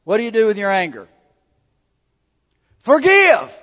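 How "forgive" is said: sudden loud, high shout of one word; powerful shout. Acted. Preacher thundering the word at the congregation. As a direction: blast it out like a command from the pulpit. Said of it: "I said it with such power".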